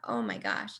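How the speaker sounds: breath taken away by realization; hushed low awe on realizing the due date. Retold or imitated. Quiet awed realization.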